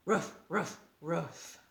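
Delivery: lightly voiced puppy ruff, fading; a hint of a puppy bark; little dog ruff. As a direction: yap like a small dog. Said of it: "little dogs say".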